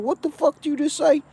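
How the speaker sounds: high, furious disbelief; can't believe what he heard; outraged. Retold or imitated. Outraged reply to insult.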